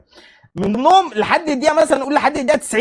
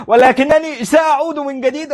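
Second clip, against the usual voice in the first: slow, higher, grand dubbed-film delivery; mock dubbed villain; 4 semitones higher, slower than usual. Mocking the defeated player as a dubbed villain, slow and grand. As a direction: declaim it like a cartoon bad guy vowing return. Movie villain line in formal Arabic.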